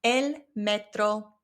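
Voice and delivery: El metro with a rolled Spanish r, slowly; El metro, Spanish vowels and tapped r; Spanish accent. Shown as a demonstration. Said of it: "just with the Spanish accent".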